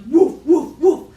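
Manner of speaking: booming playful woofs; big dog bark. Pretend. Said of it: "a big dog with a bark like".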